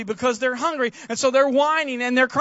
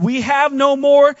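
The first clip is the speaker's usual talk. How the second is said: emphatic protest; 4 semitones higher, 9 dB louder, slower than usual. Retold or imitated. Disciples protesting to Jesus.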